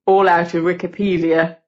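lightly put-on bass boy's voice; deepest bass voice. A narrator's reading. Gordon offers his essay from behind the tree, mock-bass voice. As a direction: play a boy dropping into his deepest bass. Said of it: "in his deepest bass voice".

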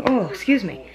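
heartburn burp interrupting. Real, not acted. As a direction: talk through a heartburn hiccup. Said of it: "I got heartburn".

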